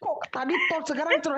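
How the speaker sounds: high, fast disbelief; incredulous. Real, not acted. Teacher reacts to a botched "cerot", high and fast in disbelief. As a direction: squeal in mock disbelief at the mistake.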